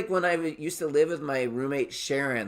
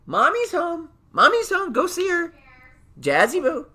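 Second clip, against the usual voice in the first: very high, loud cooing call; excited pet talk; 7 dB louder than usual. Real, not acted. Telling Jazzy Boo that mommy is home, excited pet talk. Calling to pet as partner arrives.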